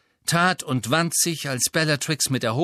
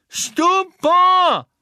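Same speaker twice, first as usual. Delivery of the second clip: much higher, louder shouted spell; Neville casting spell; 9 dB louder than usual. A narrator's reading. Neville attacking Death Eaters, shouted spell. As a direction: fling the spell with all he has. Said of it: "rief Neville erneut".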